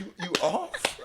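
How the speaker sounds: slow, high feigned surprise; mock disbelief, drawn out and high; acting surprised. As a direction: pretend you had no idea. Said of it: "I'm acting surprised".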